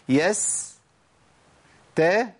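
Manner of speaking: ordinary talk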